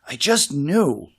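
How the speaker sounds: usual speaking voice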